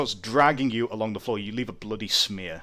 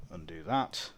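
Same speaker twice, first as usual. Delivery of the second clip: normal talking voice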